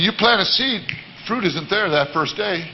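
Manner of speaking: ordinary talk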